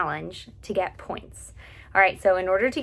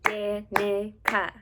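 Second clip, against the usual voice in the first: Danica said slowly, split evenly into Dan-i-ca; broken into syllables; much slower than usual. Shown as a demonstration. Music teacher showing students the syllables of Danica, broken up. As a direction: break Danica into three separate beats. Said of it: "take the word Danica".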